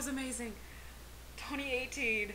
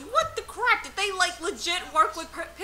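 ordinary talk, nothing marked

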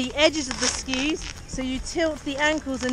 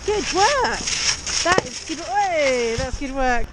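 cheery praise. Real, not acted. Praising a student's first try.

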